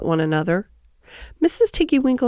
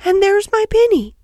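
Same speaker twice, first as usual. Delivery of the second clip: put-on high, louder excited little girl; delighted cry; 11 semitones higher, 5 dB louder than usual. A narrator's reading. Lucy spots her lost pinny, excited high cry. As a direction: cry out on spotting it, high and loud. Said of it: "cried Lucy".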